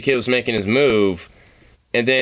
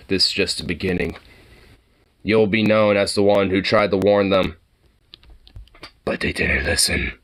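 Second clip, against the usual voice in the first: ominous movie voice; 5 semitones lower than usual. Retold or imitated. Ominous prophecy voice.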